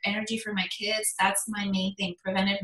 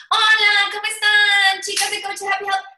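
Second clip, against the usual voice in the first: much higher and louder, beaming hello to her viewers; bubbly live host greeting; 10 semitones higher, 8 dB louder than usual. Retold or imitated. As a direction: greet the live audience with big energy. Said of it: "I'm like".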